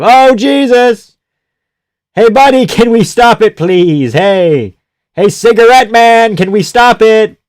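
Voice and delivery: high, loud pleading yell at the screen; begging the ref to step in; shouting at the ref. Real, not acted. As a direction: fan screaming for a stoppage. Yelling at referee to stop fight.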